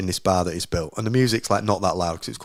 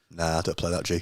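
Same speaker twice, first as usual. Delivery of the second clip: lower, softer brush-off; low dismissive mutter; 6 semitones lower, 8 dB quieter than usual. Pretend. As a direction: wave it off casually under the breath. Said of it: "I should have just gone".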